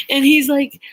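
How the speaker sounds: ordinary talk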